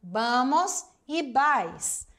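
vamos e vais said slowly, each word drawn out; slow answer. Shown as a demonstration. Teacher giving the correct answer slowly. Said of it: "a resposta correta seria".

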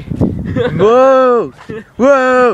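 high, loud excited whoops as the fish bites; excited whoa. Real, not acted. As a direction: cheer the catch. Fish caught on line.

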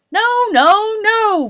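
loud high protest; shout. A narrator's reading. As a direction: cut him off loudly. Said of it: "Boris shouted".

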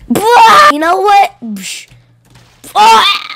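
high, loud battle yells; fighting shouts. Acted. Wrestling the four-year-old, yells loudly. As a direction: shout through the struggle.

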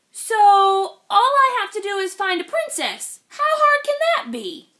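high, loud and eager; eager frog. Acted. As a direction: rise with hopeful excitement.

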